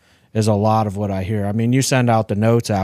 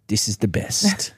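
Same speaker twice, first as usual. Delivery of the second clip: put-on Haitian accent; 4 dB quieter than usual. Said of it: "'cause of her accent".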